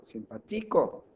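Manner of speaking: simpaTIco, TI high and stretched; Simpático said as simpaTÍco; wrong stress on TI. Shown as a demonstration. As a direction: push the beat onto TI in Simpático, slowly.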